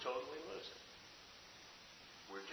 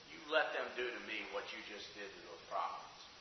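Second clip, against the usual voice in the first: menacing hush; 10 semitones lower, 11 dB quieter than usual. Acted. Preacher voices the queen's threat.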